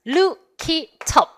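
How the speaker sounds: "Look it up" drawn out as loo-ki-tup; linked sounds. Shown as a demonstration. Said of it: "연음되는 부분".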